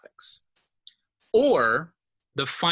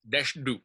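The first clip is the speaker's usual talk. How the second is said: "Desh Du" with both syllables stressed; stress on Du too; 2 semitones higher than usual. Shown as a demonstration. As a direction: give "Du" some stress after "Desh". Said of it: "So Du is also taking on some stress".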